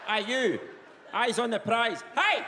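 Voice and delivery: high-pitched Perthshire holler; shouted Perthshire chat-up. Acted. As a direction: shout a clumsy come-on across the club. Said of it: "not in the Perthshire accent".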